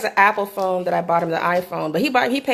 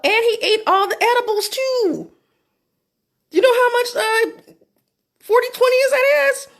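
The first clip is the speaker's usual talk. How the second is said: high, louder, worked-up retelling; alarmed excitement; 10 semitones higher, 3 dB louder than usual. Real, not acted. Telling viewers she feared he overdosed, high and alarmed. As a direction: push it up in rising alarm.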